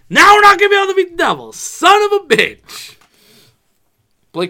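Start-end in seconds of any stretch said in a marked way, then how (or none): 0.1-3.0 frustrated outburst. Retold or imitated. Rangers fan recalling his yell at the loss, loud frustrated outburst. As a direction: blow up in disbelief. Retold outburst after Devils win.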